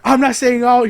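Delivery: high, loud mock disclaimer; imitated ranting shout. Mother qualifying her rant to the crowd, mock shout. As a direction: holler the caveat mid-rant.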